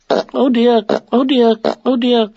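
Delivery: high, fretful little voice; worried Piglet. A narrator's reading. Piglet to himself nearing the trap, worried. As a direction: squeak a worried "oh dear" to yourself. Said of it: "said Piglet to himself".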